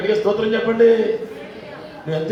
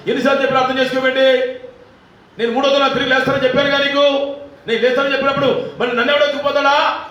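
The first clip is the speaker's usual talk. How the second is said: higher, louder, fast scolding; imitated rebuke; 6 semitones higher, 3 dB louder, faster than usual. Jesus as imagined scolding the weeping woman, fast and loud. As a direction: rattle off an impatient rebuke. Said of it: "అని గద్దించొచ్చుగా".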